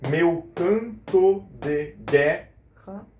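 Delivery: very slow, beat-by-beat scansion; counting out syllables. Shown as a demonstration. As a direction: chop the verse into separate syllables for the class. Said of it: "Contem comigo".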